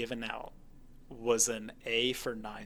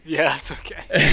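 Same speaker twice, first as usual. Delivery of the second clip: laughing; 3 semitones higher, 11 dB louder than usual. Real, not acted. Laughing about cat noise.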